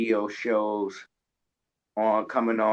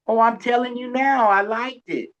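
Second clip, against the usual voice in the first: mock fan; 13 semitones higher, 5 dB louder than usual. Host mimics fan claiming he liked it.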